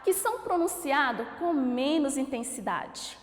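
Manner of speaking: ordinary talk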